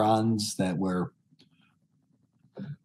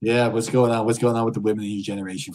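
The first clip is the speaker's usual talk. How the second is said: ordinary delivery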